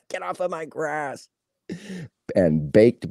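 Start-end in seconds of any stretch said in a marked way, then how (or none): 0.1-1.3 high, soft mock old man; put-on old-timer's voice; grumpy old man. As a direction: scold like a cranky old neighbour, playfully. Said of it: "He's old".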